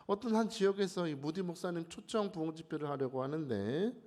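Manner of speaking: ordinary delivery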